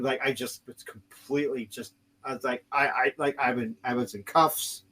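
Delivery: usual speaking voice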